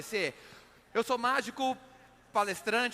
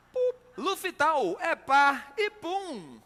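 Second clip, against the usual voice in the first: slow, drawn-out sponsor announcer; mock ad read; 6 semitones higher, 4 dB louder, much slower than usual. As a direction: stretch the ad words out.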